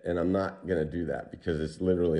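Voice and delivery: ordinary delivery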